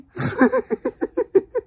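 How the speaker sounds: shrieking high laughter. Real, not acted. Laughing at own impression.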